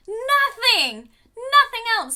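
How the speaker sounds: high, loud cry of disbelief, half-laughing; exasperated cry. Real, not acted. Vlogger wailing to viewers about an all-British cast, high and loud. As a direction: wail it out in mock despair.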